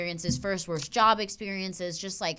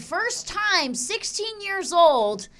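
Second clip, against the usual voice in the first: high, loud, slow disbelief; outraged punchline; 10 semitones higher, 9 dB louder than usual. Real, not acted. Telling her co-host the punchline in loud disbelief. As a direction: lean hard into the outrage.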